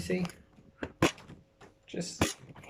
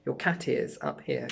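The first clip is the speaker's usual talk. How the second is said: normal talking voice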